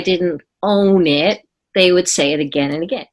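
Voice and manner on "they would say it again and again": usual speaking voice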